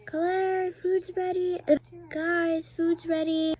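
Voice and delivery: high loud call; calling kids to dinner. Pretend. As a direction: call them to the table.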